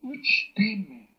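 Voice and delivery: Uchteme said high and clear; demo word. Shown as a demonstration. Teacher reads a native word to the class, high. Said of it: "Entonces se pronuncia aquí".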